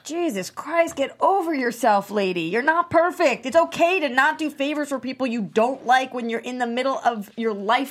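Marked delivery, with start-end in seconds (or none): 0.0-5.3 louder, higher, fed up; angry outburst. Acted. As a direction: snap at her to get over herself. Heated family confrontation.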